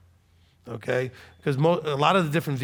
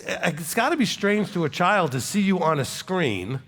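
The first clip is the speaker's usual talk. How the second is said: ordinary delivery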